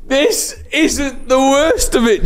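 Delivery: very high, slow squeal out of a laughing fit; helpless mortified squeal. Real, not acted. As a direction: wail it high, overcome with embarrassed laughter. Laughing helplessly at own shame.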